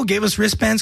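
ordinary talk, nothing marked

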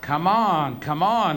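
loud, rising-and-falling pleas; impatient urging. Acted. Bettor pressing the ticket seller, impatient urging. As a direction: push the seller to hurry, high and loud.

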